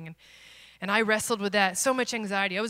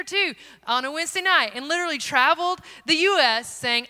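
ordinary talk

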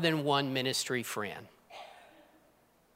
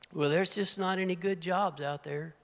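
mock complainer; 7 dB quieter than usual. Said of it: "That's a lie".

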